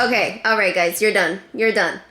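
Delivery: loud, firm telling-off; scolding pets. Real, not acted. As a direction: stop them with a firm loud voice. Breaking up the pets.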